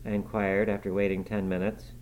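ordinary delivery

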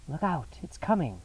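restrained cry of alarm; urgent warning cry. A narrator's reading. Holmes alerting his companions to the approaching thing, urgent warning. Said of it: "cried Holmes".

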